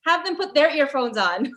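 loud, fast, high mock warning; mock announcement. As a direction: announce it like an alarm.

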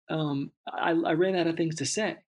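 ordinary talk, nothing marked

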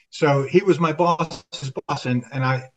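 usual speaking voice

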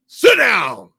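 loud, high snap of an order; angry teacher ordering a student to sit; sharp command. Retold or imitated. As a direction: cut him off hard.

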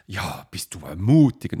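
mock the other side's snide comment; sarcastic retort. Colleagues mocking his answer.

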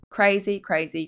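usual speaking voice

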